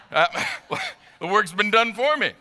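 high, loud burst through laughter; laughing. Real, not acted. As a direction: laugh your way through the words. Laughing at own gardening optimism.

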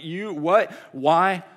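loud, high burst of disbelief; dumbfounded. Retold or imitated. Owner stunned by her coach's forgiveness, loud and incredulous. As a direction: react completely floored, imitating her shock. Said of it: "she is just floored. She is dumbfounded".

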